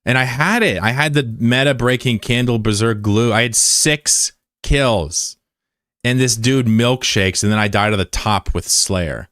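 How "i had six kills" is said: slow lament. Real, not acted. Lamenting lost game.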